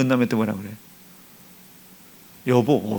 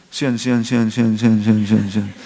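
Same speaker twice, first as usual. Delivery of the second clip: repeated calling; 5 semitones lower, 3 dB louder than usual. Retold or imitated. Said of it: "십 년치 다 한 거예요".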